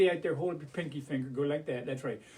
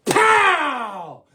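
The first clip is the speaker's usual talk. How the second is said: loud, high, drawn-out bang noise; big pow; 6 semitones higher, 7 dB louder than usual. Shown as a demonstration. Storyteller popping a paper bag for kids, explosive pow. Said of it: "give it a big pow".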